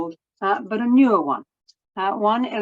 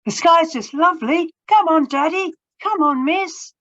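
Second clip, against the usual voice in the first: higher, louder gleeful calling down; excited child calling; 11 semitones higher, 5 dB louder than usual. Acted. Flying children calling to the adults below, excited. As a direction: call down to the grown-ups, thrilled.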